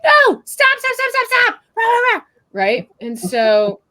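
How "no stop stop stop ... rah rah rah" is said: much higher, louder panicked yelling of stop; mock a parent losing it at the mud; frantic scolding. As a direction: parent yelling at a muddy kid, high and frantic. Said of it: "anyone's instinct, mine included, would be like".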